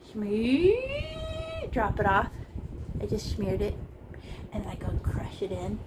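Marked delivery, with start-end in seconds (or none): 0.1-1.7 squeaky sound effect. Pretend. Sound for dropping paint.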